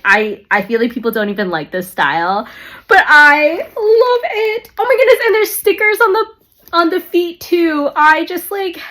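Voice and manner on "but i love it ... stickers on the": tearful. Real, not acted. Vlogger defending the bag she loves, tearfully. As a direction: choke out the love through tears.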